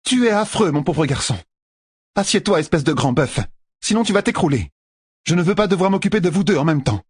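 voix aiguë de vieille sage-dame bourrue; Nineveh qui gronde. A narrator's reading. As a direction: gronder le grand bœuf. (la sage-dame rabroue Perrine, bourrue et aiguë)

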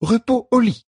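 high, firm midwife voice; midwife's verdict. A narrator's reading. Midwife orders the queen to bed, firmly. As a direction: hand down the order to rest. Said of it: "annonça Melfein".

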